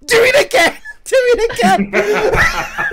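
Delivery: high, loud laughing plea; laughing cheer. Retold or imitated. Staff begging, laughing.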